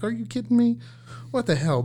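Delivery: high-pitched exasperated disbelief; retold frustration. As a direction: react as if the job just went wrong, pitch jumping up. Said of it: "it's frustrating".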